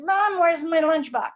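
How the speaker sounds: put-on child interrupting. Child walking in on talking parents, loud interruption. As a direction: blurt it out like a kid who missed the pause. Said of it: "It's like".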